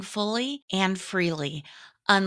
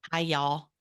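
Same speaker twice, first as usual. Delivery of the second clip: put-on own Southern accent; 4 semitones lower than usual. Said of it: "mine is just so".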